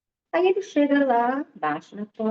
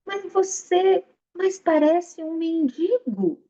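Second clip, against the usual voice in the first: mocking; 7 semitones higher, slower than usual. Sage mocking the armed samurai.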